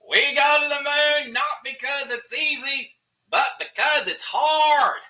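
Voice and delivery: put-on high, slow, hammy Massachusetts accent; a bad Massachusetts accent with a Kennedy-style speech delivery; JFK Massachusetts accent. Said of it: "me doing a Massachusetts accent, which is horrible".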